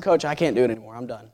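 low, faded, exhausted plea; worn-out plea. Retold or imitated. Fighter telling his coach he can't go on, spent and fading. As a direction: drop the voice as if you have nothing left.

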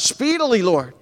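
high, pleading cry to the Lord; urgent plea. Acted. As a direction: beg God to hurry. Pastor voicing David's plea.

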